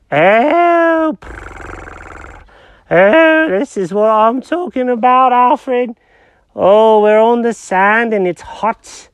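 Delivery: high-pitched confused cat; squeaky cartoon cat, lost and puzzled. Jenkins voice at beach.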